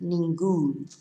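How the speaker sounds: "ningún" as nin-GUN, last syllable stressed; stress on word ending. Shown as a demonstration. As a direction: put the weight on GUN in "ningún". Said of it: "to stress the end of the word".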